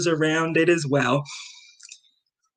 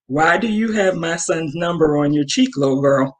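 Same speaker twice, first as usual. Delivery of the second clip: blunt challenge. Retold or imitated. Mom to the girl, what she really wanted to say, blunt. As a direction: drop the politeness and ask it straight. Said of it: "That's what I really wanted to say".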